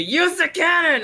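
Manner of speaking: high, loud, slow yell; mock shout. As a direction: bellow the order. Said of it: "everyone told me".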